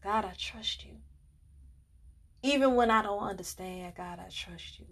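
soft, near-whispered prayer to God; hushed prayer. Retold or imitated. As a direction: pray quietly, barely above a breath. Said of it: "and I was just saying".

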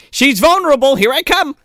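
high, loud mock excitement; eager boy. Boy rushing to the girl after her call, mock eagerness. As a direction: blurt it out, hammed-up and raring to go.

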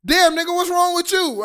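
high, mock-confrontational demand; retold aggressive text. Quoting a girl's text to the guys, mock aggressive. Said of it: "that's pretty aggressive".

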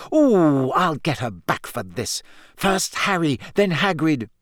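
swinging pitch, vengeful; fuming, mildly hammed-up; enraged. A narrator's reading. Hermione vowing revenge on Rita, enraged. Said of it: "shaking with rage".

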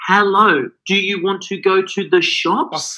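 put-on high, slow, patronising baby talk; sing-song voice you'd use on a baby. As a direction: talk down as if to a toddler. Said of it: "they get talked to like a baby".

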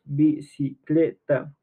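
"Bicicleta" slowly split into bi, ci, cle, ta; teacher counting out the syllables of "Bicicleta". Shown as a demonstration. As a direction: break "Bicicleta" into four slow, separate syllables. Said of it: "tiene cuatro sílabas".

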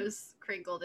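normal talking voice

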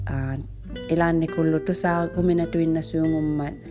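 ordinary delivery

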